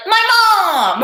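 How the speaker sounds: loud, drawn-out comic wail; hammed-up wounded cry; mock outburst. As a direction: blurt it as an exaggerated accusation. Acted comeback to "who hurt you.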